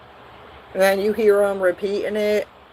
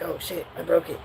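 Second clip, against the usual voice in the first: hushed oops; 3 semitones lower, 7 dB quieter than usual. Retold or imitated. Sibling secretly breaks a gift.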